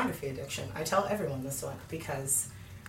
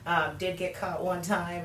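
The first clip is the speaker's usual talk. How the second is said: usual speaking voice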